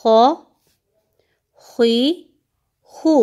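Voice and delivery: खौ खुई खू said thick with rounded lips; heavy kho. Shown as a demonstration. Teacher modelling heavy kh syllables for pupils. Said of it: "खौ को भी हम मोटा करेंगे".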